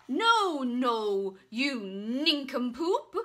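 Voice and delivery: loud, indignant bluster; blustering knight. Acted. Knight scolding the shopkeeper, loud blustering. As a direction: splutter with outrage. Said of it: "blustered the knight".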